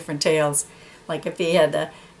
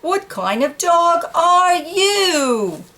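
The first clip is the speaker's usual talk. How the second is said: scornful dog; 10 semitones higher, much slower than usual. Acted. Dog scoffing.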